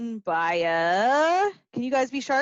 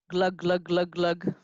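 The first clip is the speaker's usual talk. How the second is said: low glugging; 7 semitones lower than usual. Acted. Sound effect of frog drinking.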